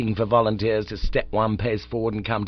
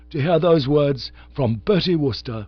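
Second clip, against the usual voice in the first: sorrowful; 5 semitones higher, 5 dB louder than usual. A narrator's reading. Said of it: "said Bingo sorrowfully".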